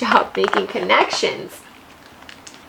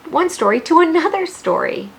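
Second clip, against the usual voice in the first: excited; 7 semitones higher, 4 dB louder than usual. Real, not acted. Teacher stresses the lesson point.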